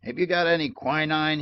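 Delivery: plain reading voice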